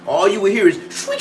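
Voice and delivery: usual speaking voice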